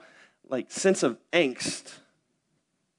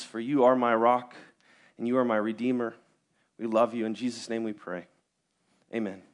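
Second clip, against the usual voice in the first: prayer; 6 semitones lower than usual. Acted. Pastor's opening prayer.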